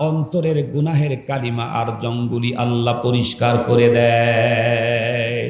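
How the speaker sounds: chanted sermon on level held notes; melodic waz preaching. Real, not acted. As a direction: intone it, drawing out the last word.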